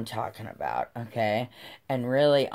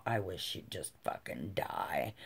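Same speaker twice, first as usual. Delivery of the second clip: muttering; 6 semitones lower, 13 dB quieter than usual. Retold or imitated. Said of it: "I started going".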